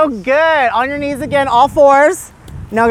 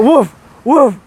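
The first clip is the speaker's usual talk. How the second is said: big dog bark. Pretend. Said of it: "wanna hear it".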